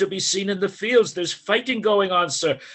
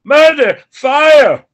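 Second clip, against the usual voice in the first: loud, frantic cries for help; panicked shouting; 6 semitones higher, 10 dB louder, much slower than usual. Acted. Servant calling for help after a fleeing man, loud panicked shouts. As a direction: yell the alarm across the street.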